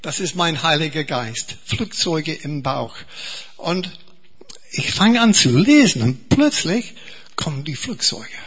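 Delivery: ordinary delivery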